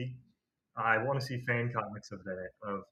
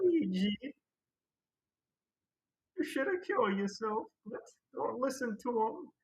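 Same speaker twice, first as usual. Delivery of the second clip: very high, cartoony brothers' voices; Mario and Luigi impressions; 11 semitones higher than usual. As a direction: ham up a silly Mario-Luigi skit. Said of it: "I'm losing the voices".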